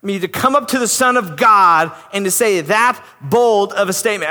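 usual speaking voice

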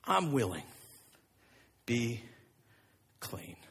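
soft, low, slow words of healing; Jesus quietly heals the man; tender command. Acted. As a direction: speak with gentle authority. Jesus speaks to the leper.

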